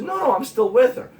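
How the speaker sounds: retold friend's casual denial; friend's reply. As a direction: play the friend lightly defensive. Friend answers, voiced retold.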